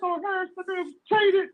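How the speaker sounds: whiny falsetto impression of a complaining fan; mocking Reggie. Teasing a Colts fan with a whiny squeal. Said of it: "He's gonna say".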